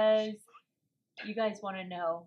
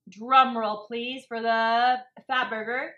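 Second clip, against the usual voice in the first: loud, slow drum roll announcement; announcer build-up; 9 dB louder, slower than usual. Acted. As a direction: hype the reveal like a host. Announcing the big reveal.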